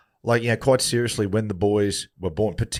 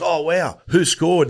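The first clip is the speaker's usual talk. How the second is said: mock surprise; 7 semitones higher, 4 dB louder, much slower than usual. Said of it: "And he goes".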